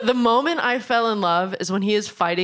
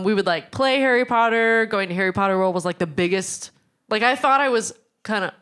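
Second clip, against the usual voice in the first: usual speaking voice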